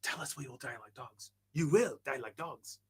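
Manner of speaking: very soft whispered movie quote; hushed imitation, slowing on the last phrase; imitated whisper. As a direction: whisper the line dramatically, barely voiced. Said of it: "he whispers it".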